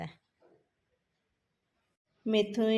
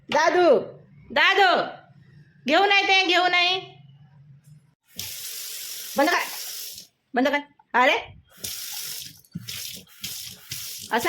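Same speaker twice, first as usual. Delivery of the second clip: high, much louder coaxing and calling; 9 semitones higher, 10 dB louder, faster than usual. Real, not acted. Mother calling and coaxing toddler. (बाळाला मोठ्या लाडिक आवाजात बोलावणे)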